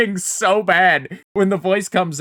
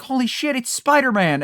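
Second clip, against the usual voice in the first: higher-pitched, thrilled mock exclamation; excited child; 8 semitones higher than usual. Nine-year-old at a birthday party, excited. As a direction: play a kid spotting a hero.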